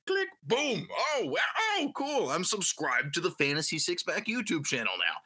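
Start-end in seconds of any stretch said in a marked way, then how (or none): 0.1-2.3 high, delighted gasps; mock excitement. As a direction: play the thrilled new subscriber. Acting excited subscriber.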